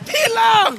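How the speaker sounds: high, loud burst of surprise; shout. Real, not acted. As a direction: yell it out as the kill lands. Sudden kill in fight. (jeritan kuat kerana terkejut)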